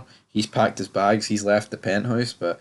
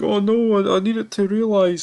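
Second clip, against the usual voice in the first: mock panicked whine; 12 semitones higher, 4 dB louder than usual. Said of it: "And she's like".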